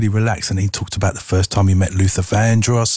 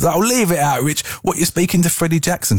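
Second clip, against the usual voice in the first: teasing coworkers; 9 semitones higher than usual. Retold or imitated.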